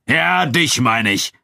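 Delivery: slow, high, pointed call; Abu Dun calling out. A narrator's reading. Nubian fighter to his departing friend, calling out. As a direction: stretch it out to single someone out. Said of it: "rief Abu Dun".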